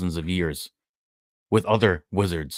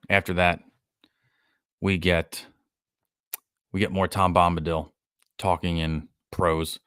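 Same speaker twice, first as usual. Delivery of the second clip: ordinary talk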